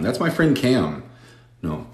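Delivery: normal talking voice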